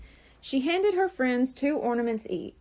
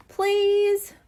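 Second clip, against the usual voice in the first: high, drawn-out little plea; pleading; 10 semitones higher, 4 dB louder than usual. A narrator's reading. Coral begging her mom to stay up, pleading. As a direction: beg sweetly like a kitten. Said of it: "Coral asked".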